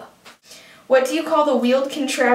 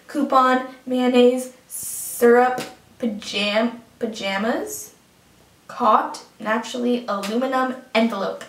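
ordinary talk, nothing marked

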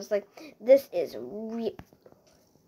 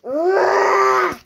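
long, loud high cry of frustration; drawn-out exasperated yell; frustrated scream; 9 semitones higher, 9 dB louder than usual. Real, not acted. Player fed up with creepers blowing stuff up, frustrated scream.

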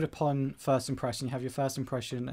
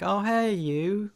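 fake friendly hello; 6 semitones higher, 5 dB louder, much slower than usual. Acted vague greeting.

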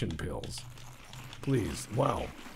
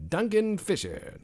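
put-on mid-Atlantic radio accent; 11 semitones higher, 4 dB louder than usual. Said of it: "some people say it very strangely".

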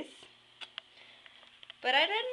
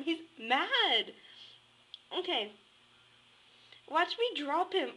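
ordinary talk

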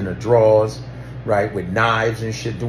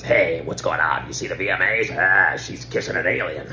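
lower, raspy ghoul voice; 4 semitones lower than usual. Retold or imitated. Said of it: "[name] sounds like Beetlejuice".

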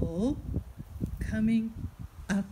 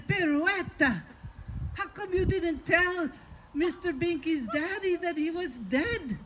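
lightly voiced incredulous husband, higher pitch; a touch of reproach, pitched up; scolding husband; 5 semitones higher than usual. Husband questions wife about the call, mildly scolding.

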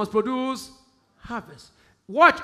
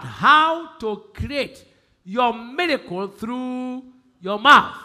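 slow and louder, stretching How to create your miracle through your mouth; slow emphatic repeat; 3 semitones higher, 6 dB louder, much slower than usual. Shown as a demonstration. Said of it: "I'm going to tell".

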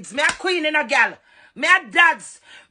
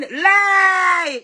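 sudden shout; 5 semitones higher than usual. Real, not acted. Ranting at Trisha on her live, sudden yelling cry. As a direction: throw the word out with a cry.

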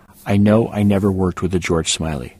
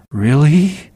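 hopeful; 8 semitones higher, 7 dB louder than usual. A narrator's reading. Hopeful question.

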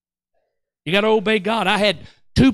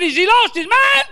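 high, loud incredulous cry; indignant high-pitched rant; worked-up outrage; 12 semitones higher, 6 dB louder than usual. Retold or imitated. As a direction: holler it in disbelief. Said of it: "I'm getting louder and louder".

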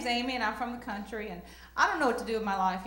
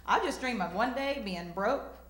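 usual speaking voice

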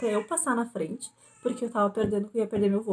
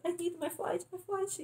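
high, soft pleading; desperate plea; 10 semitones higher, 7 dB quieter, slower than usual. Retold or imitated. Nervous traveler pleading at the closed gate, high and soft. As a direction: squeak out the plea in panic. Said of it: "Eu já tava nervosa".

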